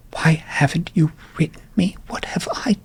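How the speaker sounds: dramatic breathy whisper, wounded plea; whispered reproach. Acted. Sire asking Jessica why no letters came, whispered reproach. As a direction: whisper it like an injured parent.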